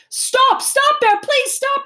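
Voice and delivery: high, loud, panicked pleading; frantic plea. A narrator's reading. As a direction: shout to stop a friend in danger. Pleading as friend dives in.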